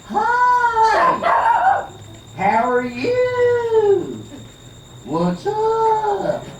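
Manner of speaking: very high, drawn-out Southern greeting; squeaky, stretched-out Southern hi; Southern accent. Retold or imitated. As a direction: sing out a high, slow Southern hello. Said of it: "They all talk like this".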